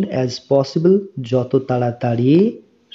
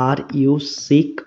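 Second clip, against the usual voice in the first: very slow, words drawn apart; slow model reading; much slower than usual. Shown as a demonstration. Teacher reading the question slowly to students. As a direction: say it slowly so learners can repeat. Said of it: "teacher models English phrase".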